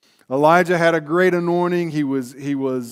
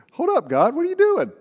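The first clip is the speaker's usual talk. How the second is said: high-pitched, squeaky objection to God; mock protest; 10 semitones higher, faster than usual.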